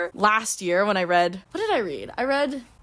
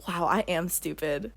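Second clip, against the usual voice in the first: ordinary talk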